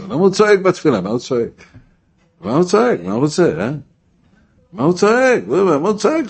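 high, loud mock outrage; indignant objection. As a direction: complain loudly like a scandalized onlooker. Mimics people objecting to his shouting.

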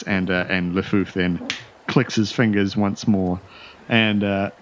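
ordinary delivery

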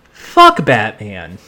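low, slow and offhand; funny deadpan line. Retold or imitated. Hero dusts himself off, talking to himself, offhand. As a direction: mutter it to yourself, dropping low. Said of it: "It was a funny delivery".

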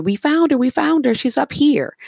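high, loud excited call; screaming down. Acted. Daughters calling down to their mother, screamed news. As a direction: call it out high to someone downstairs. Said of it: "they scream down to their mother".

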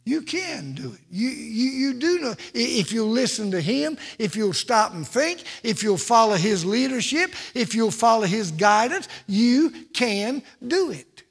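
loud, rising preacher cadence, hammering each line; full-force preaching. Acted. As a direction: preach it to the back pew.

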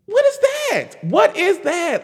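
high-pitched, baffled outburst; incredulous. Real, not acted. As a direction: exclaim it high, in total disbelief. Baffled reaction to the documentary.

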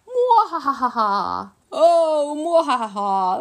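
high, loud, slow villain cackle; sneering panto villain. Acted. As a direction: ham up the evil laugh, rising on oh. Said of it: "Grandpa Gory laughed".

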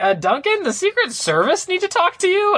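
high, startled teacher voice; a lightly voiced teacher caught off guard; flustered teacher. Teacher interrupted mid-lecture telling Duncan, high and startled. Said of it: "then she's like".

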